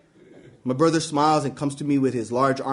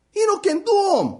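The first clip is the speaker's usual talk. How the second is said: "He no can do 'em" pitched much higher, voice cracking up with exasperation; exasperated dad; 3 dB louder than usual. Acted. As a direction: whine it, way up high. Dad repeats complaint, higher.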